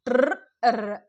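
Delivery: "ట్ర్, ఎర్" loud with a rolled r; sounding out a cluster. Shown as a demonstration. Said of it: "two కంటే ఎక్కువ sounds వచ్చినాయి". ("ట్ర్, ఎర్" శబ్దాలు విడదీసి)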